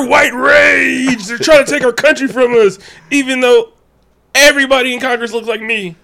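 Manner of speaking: loud, high mocking rage rant. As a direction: shout it like an outraged bigot.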